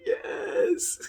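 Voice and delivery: long, high, loud yes; triumphant cheer. Retold or imitated. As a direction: cry it out in victory, drawn out. Acts out parent's triumphant reaction.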